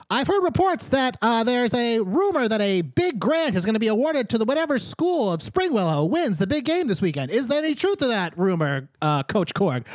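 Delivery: put-on high, chirpy young reporter; eager kid reporter. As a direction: pipe up like a keen gnome kid. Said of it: "[name] goes on to say".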